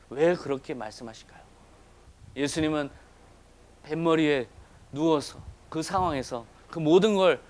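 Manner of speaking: ordinary talk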